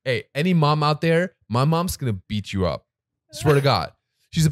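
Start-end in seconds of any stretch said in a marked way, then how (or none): none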